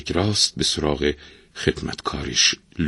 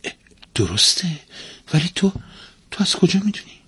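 high-pitched, halting surprise; puzzled wife, caught off guard; astonished; 12 semitones higher, faster than usual. A narrator's reading. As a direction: falter in surprise, voice climbing high. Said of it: "با تعجب نگاهی به شوهرش انداخت و گفت".

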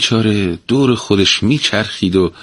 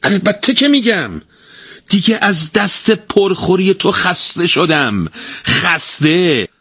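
high, slow and emphatic, dragging خسته; a weary wife insisting firmly; firm, decisive; 12 semitones higher, slower than usual. A narrator's reading. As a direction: state it with no room to argue. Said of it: "با قاطعیت گفت".

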